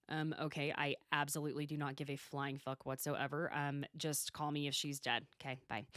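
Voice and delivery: low, soft, unbothered monotone; indifferent Travis. Retold or imitated. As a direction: brush the caller off without any feeling. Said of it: "Travis is literally just kinda like".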